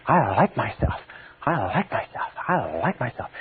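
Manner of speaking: mean every word, tenderly; with real feeling. Shown as a demonstration. Teacher showing how to feel it: soft, heartfelt. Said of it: "You say".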